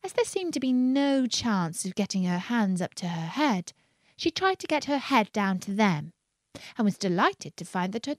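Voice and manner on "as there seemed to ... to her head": plain reading voice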